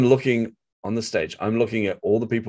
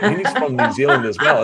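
laughing through a mock-confused thought; half-laughing, playing dumb; amused disbelief; 4 semitones higher, 5 dB louder than usual. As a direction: chuckle as you say it. Retold confused self-talk, laughing.

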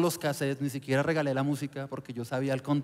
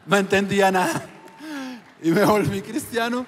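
laughing; 8 semitones higher, 5 dB louder than usual. Real, not acted. Laughing at himself.

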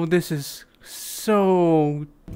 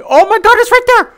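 shouting it out, thrilled; excited shout; 10 dB louder than usual. Real, not acted. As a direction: yell with sudden excitement. Spotting the building below.

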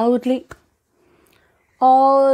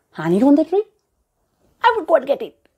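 higher, louder eager voice; eager lizard; 6 semitones higher, 6 dB louder, faster than usual. A narrator's reading. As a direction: jump at the idea of honey.

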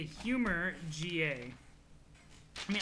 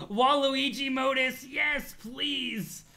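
higher, slow, gleeful plea; real excitement; 5 semitones higher, 4 dB louder, much slower than usual. Real, not acted. Reader wishing for a Waluigi modus, excitedly. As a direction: stretch it out with delighted eagerness.

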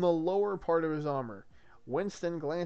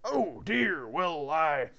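put-on wavering accent, meant Irish, heard Southern; muddled Southern accent; 3 dB louder, much slower than usual. Galahad wavers before the maidens, muddled Southern-Irish accent. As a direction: attempt Irish and slip toward Southern. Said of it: "Why is he Southern now?".

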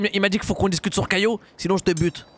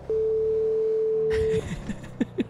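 laughing; 14 semitones higher, 5 dB quieter than usual. Real, not acted. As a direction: burst of laughter. Laughing on the phone.

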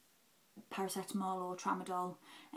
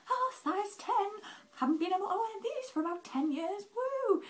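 very high-pitched, thrilled re-enactment; gleeful squeal; 13 semitones higher, 4 dB louder than usual. Retold or imitated. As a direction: squeal it in giddy delight, ending on a whoop. Acts delight at fitting smaller size.

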